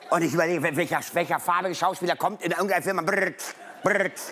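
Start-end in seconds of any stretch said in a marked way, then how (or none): none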